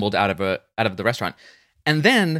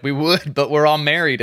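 light mock yell, a little raised; retold yell; 5 semitones higher, 4 dB louder than usual. Extra heckling the charity auction, mock yell. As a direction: call it out like a heckler in the crowd. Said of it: "yells out".

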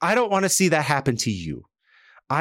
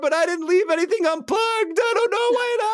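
crying cheesemonger. Retold or imitated. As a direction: stretch each word out like a man in tears, pitched way up. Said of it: "Billy Bob cries on the phone".

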